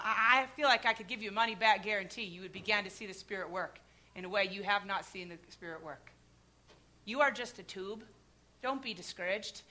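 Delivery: ordinary talk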